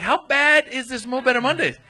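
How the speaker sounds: high, loud incredulous question; retold alarm. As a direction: replay your alarm, pitched right up. Retelling his alarm before the gig.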